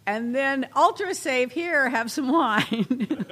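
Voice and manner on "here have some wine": slow, laughing through the offer; laughing punchline. Acted. Host laughs through the wine punchline, slow. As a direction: chuckle while offering wine slowly.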